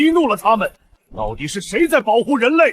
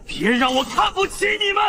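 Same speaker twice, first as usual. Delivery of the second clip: taunting shout; 10 semitones higher than usual. Acted. Villain goads the heroes.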